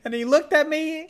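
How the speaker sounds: high, quick, smitten squeal; gushing. Real, not acted. Telling friend how the dog looked at me, gushing. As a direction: melt over the cute dog in a high voice.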